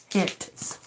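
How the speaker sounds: Hence whispered through a laugh; amused whisper. Real, not acted. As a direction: breathe Hence out, half laughing. Repeating a word, laughing.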